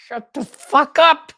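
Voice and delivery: loud, high, slow strained shout; exasperated yell. Acted. Man fed up with crying, exasperated yelling. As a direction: yell it word by word, high-pitched. Acted outburst at crying woman.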